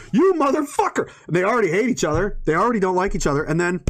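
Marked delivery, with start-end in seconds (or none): 0.1-1.1 high, hammed-up furious yell; acted angry shout. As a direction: curse the neighbour from next door. Neighbour finds the rock.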